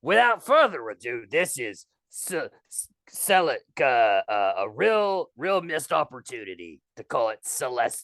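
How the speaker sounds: low, halting fake British accent. Guest introducing his Pokemon song, stumbling in fake British accent. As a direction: fumble the song title in mock Cockney. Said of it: "British or Australian or what are you".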